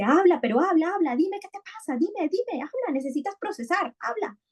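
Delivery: high, squawky hen voice urging him to talk; pushy hen. Retold or imitated. Hen in the picture book pressing the sad boy to talk, high and insistent. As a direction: play a nagging hen pecking at the boy. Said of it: "llega una gallina y le dice".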